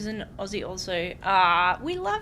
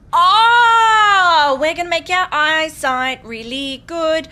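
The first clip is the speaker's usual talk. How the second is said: excited; 6 semitones higher, 12 dB louder, slower than usual. Real, not acted. Excited discovery in game.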